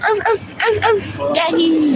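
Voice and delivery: put-on high, slow baby talk to a doggy; cooing at a dog. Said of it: "I'm like".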